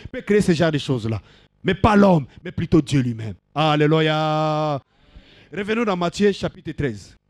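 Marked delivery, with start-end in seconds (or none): 3.6-4.8 sustained, level preacher's call; drawn-out hallelujah. Real, not acted. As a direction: hold Alléluia on one long level note. Preacher calls out to congregation.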